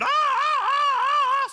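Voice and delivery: shrill, very high, loud mock scream; a hammed-up scream of panic. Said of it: "they both started screaming".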